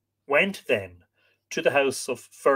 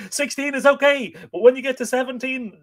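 playful mock; 12 semitones higher, 6 dB louder than usual. Joking about count.

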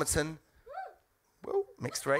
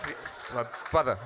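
normal talking voice

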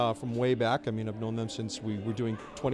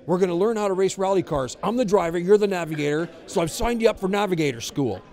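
louder, higher, pumped-up brother announcing a plan; excited brother; 4 semitones higher, 5 dB louder than usual. Retold or imitated. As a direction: sell the rally-car idea like an eager big brother. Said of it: "call me up and say".

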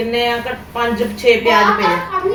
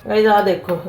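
normal talking voice

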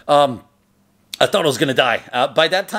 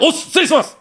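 high, loud formal dojo apology; shouted apology; 14 semitones higher, 4 dB louder, faster than usual. Retold or imitated. Student apologizing to the master for whatever, high shout. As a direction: shout it standing to attention. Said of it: "so I stand up, I'm like".

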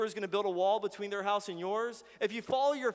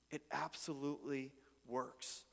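usual speaking voice

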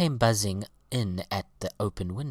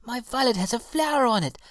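put-on high, loud, quick little-girl delight; fast, high-pitched excitement; excited cry; 13 semitones higher, 5 dB louder, faster than usual. A narrator's reading. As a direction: announce it in a thrilled, high child's voice. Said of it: "cried the little girl".

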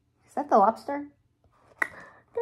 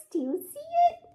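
high-pitched question; much faster than usual. Real, not acted. Asking the child listener.

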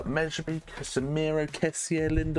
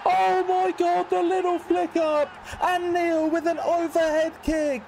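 yelling from the sidelines; 10 semitones higher than usual. Real, not acted. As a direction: scream it like a fan in the stands. Overhead kick goal.

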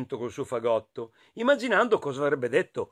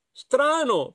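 hedgehog thinking; 10 semitones higher, 4 dB louder than usual. Acted. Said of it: "pensò Riccio".

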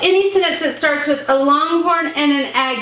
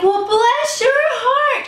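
much higher and very slow, sugary sincere "bless your heart"; sweet bless your heart; 9 semitones higher, much slower than usual. Shown as a demonstration. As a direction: coo it like happy news for them. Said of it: "It's all about inflection".

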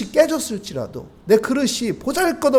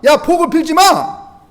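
higher, louder, slow scolding shout; stern divine command; 8 semitones higher, 8 dB louder, slower than usual. Acted. God sharply forbids Jeremiah to pray for blessing.

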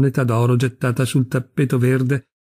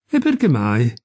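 impertinent. A narrator's reading. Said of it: "chiese con impertinenza".

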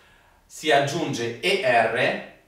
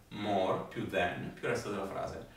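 much softer, lower echo of the rule; soft repeat; 5 semitones lower, 11 dB quieter than usual. Shown as a demonstration. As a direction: murmur it back quietly. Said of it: "Ripetete con me". (ripetizione sottovoce)

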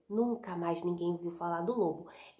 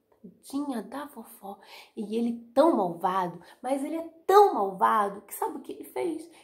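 plain reading voice

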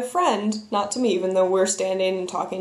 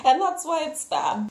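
higher rambling put-on voice of panicked excuses; flustered excuses; 5 semitones higher than usual. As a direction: babble excuses in a funny higher voice. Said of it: "Is that a little Bill Cosby in there? ... what that voice was".

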